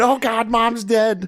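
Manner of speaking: high, slow mock wail; panicked sister. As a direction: drag it out in terrified falsetto.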